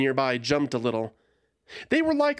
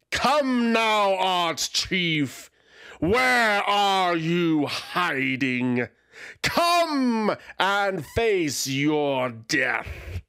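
dragon's roaring challenge; 6 semitones higher, much slower than usual. Acted. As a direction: bellow it like a dragon hunting prey. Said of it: "a proud voice shouted".

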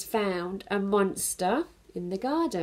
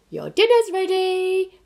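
higher, louder, slow sing-song call; calling mum; 13 semitones higher, 10 dB louder than usual. Acted. Mum calls Bernard to his dinner. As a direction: call it across the house.

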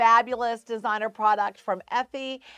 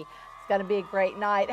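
ordinary talk

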